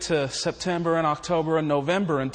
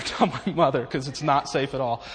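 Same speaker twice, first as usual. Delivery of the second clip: ordinary delivery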